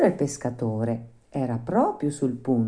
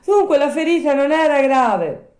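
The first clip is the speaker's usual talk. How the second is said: high, loud puppet voice; bright high-pitched exclamation; relieved puppet; 13 semitones higher, 8 dB louder than usual. Acted.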